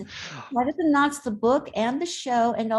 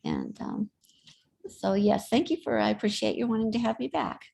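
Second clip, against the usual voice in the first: normal talking voice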